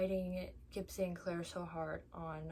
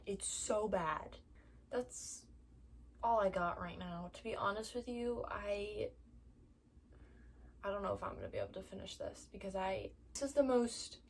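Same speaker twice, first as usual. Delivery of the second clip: usual speaking voice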